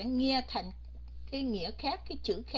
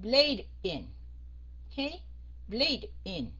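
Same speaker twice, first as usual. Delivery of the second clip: BLADE, a tiny break, then IN; stop between words; much slower than usual. Shown as a demonstration. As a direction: cut cleanly after Blade before saying in. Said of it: "Sometimes mình phải stop, mình phải ngừng". (Blade, ngừng một chút, rồi in)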